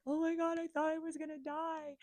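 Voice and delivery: high, loud frightened gasp of relief; reliving the terror of thinking she'd die; retold panic. Telling her friend after the panic attack, high and scared. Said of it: "And I was like".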